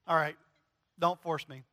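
usual speaking voice

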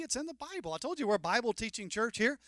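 ordinary talk

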